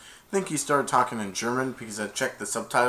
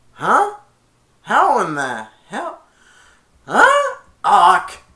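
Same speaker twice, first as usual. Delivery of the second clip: high, loud squawking parrot; a kid squawking like the parrot; Iago squawk; 10 semitones higher, 11 dB louder, much slower than usual. Retold or imitated. The parrot Iago startled, high loud squawk.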